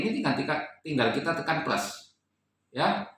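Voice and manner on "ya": normal talking voice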